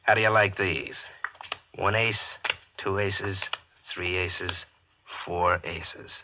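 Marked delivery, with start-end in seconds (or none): 0.0-3.0 smug card-table triumph; gloating. Acted. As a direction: lay down the winning hand with glee. Gloating reveal of winning hand.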